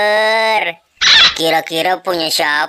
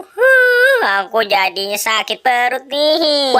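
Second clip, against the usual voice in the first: whiny crying complaint; 4 semitones higher than usual. Acted. Emon whines to Boy about his stomach ache, crying. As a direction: wail it like a child with a sore stomach.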